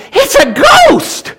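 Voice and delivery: high, loud scream of fear; terrified cry. Acted. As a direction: shriek it like they've seen a ghost. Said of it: "they cried out in fear".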